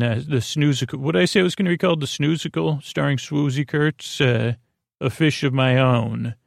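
usual speaking voice

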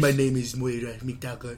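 deep, quiet, bad Scottish accent; a very low, soft attempt at Scottish. Shown as a demonstration. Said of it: "I'll do my best Scottish accent".

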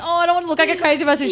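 high, fast, loud fretting; anxious protest. Retold or imitated. Daughter fretting to mum, high fast protest. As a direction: rush it out, embarrassed. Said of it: "And I'm like".

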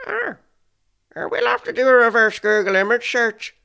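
high, loud Northern Irish voice; Northern Irish accent. Acted. Friend suggesting an image search, loud Northern Irish accent. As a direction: pitch up and belt it in Northern Irish. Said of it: "try to do this in a Northern Irish accent".

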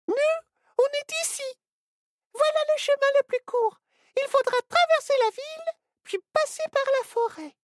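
put-on very high, fast little-girl voice; eager tiny girl showing the way; little girl Ciboulette. Little girl explains the map to her friends, eager and very high.

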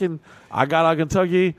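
normal talking voice

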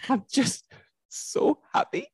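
high, breathless sob-squeak; mock-weepy high wail; crying happy. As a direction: squeak it out through happy tears. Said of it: "I just started crying".

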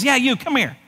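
a touch of parrot heckle; parrot calling out. Acted. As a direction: call out like a cheeky parrot. Parrot summons customer again.

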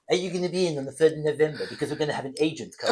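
a lightly put-on official caller voice; TV licence caller. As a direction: read it like a stiff official letter. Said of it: "this is what they sound like".

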